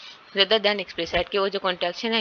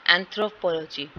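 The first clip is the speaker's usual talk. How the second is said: "Anthropology" with weight on anthro; measured AN-thro-po-LO-gy for "anthropology"; secondary stress demo. Shown as a demonstration. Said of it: "ये secondary stress वाला syllable है".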